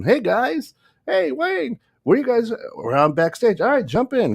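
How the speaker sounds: high, cheery mock mayor calling out; Wayne Jett the mayor. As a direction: call out like a jolly mayor pulling up in a cart. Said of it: "the mayor comes rolling in".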